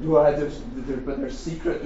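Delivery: ordinary talk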